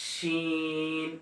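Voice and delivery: শিন with a long held sh, drawn out slowly; Arabic letter sheen. Shown as a demonstration. Teacher demonstrating শিন for students, long held hiss. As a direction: say শিন as a slow, sustained Arabic shin. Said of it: "আপনার শিন".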